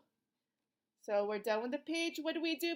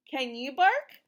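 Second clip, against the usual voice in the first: ordinary talk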